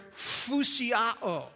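"fusiao" with the opening f blown like air; sounds like inflating. Shown as a demonstration. Preacher teaching the Greek verb "fusiao", said like its meaning, a puff of air. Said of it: "it sounds like its meaning".

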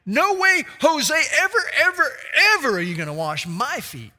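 high, loud, pleading "No way, Jose"; emphatic refusal. Acted. Peter refusing to let Jesus wash his feet, loud. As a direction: refuse with everything you have.

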